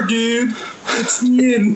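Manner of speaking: laid-back surfer dude. Acted. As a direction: talk like a chill surfer. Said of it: "I'm just gonna talk like a surfer".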